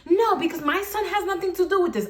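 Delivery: high-pitched mock protest; protesting mother. Storyteller mimics the mother shielding her son, high indignant protest. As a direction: imitate her indignant objection.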